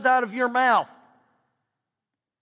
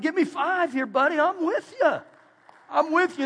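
higher, bright cheering words; hearty encouragement; 7 semitones higher than usual. Retold or imitated. Pastor greeting a man off dope, hearty encouragement. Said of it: "I said".

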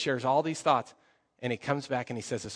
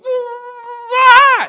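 imitated high, piercing scream; imitowany krzyk przestrachu; 19 dB louder than usual. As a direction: scream like a startled little girl, very loud. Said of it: "And she goes".

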